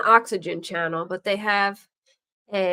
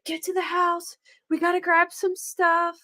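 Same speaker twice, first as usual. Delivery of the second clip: urgent mom; 10 semitones higher than usual. Retold or imitated. Voicing mom in prank story.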